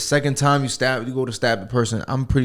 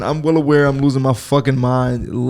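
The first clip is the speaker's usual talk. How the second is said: ordinary delivery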